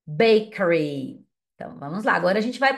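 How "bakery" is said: "Bakery" drawn out slowly; a slow, careful "Bakery"; teacher slowly modelling "Bakery"; slow model word. Shown as a demonstration. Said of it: "eu falo depois pra você checar".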